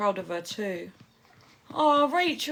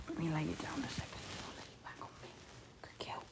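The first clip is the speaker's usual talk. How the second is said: whisper; 4 semitones lower, 16 dB quieter, faster than usual. Real, not acted. Settling baby, whispering.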